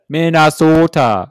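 loud, drawn-out Minnesota accent. Shown as a demonstration. Coaching a co-host on Brian's home state, hammed-up Minnesota accent. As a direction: stretch MINNESOTA out in an exaggerated Minnesota accent. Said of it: "Brian's from Minnes".

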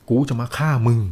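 storyteller's restrained loud threat; retold loud threat. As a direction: voice the spirit leader's death threat. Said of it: "แล้วกล่าวเสียงดัง".

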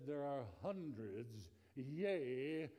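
put-on slow, solemn, old-fashioned preacher voice; a hint of a grand King James style elder; deep King James voice. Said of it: "in his deep voice ... King James English".

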